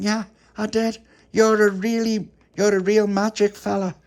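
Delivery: high, loud admiring voice; high child full of wonder; awed child. Acted. Pauline awed by magician.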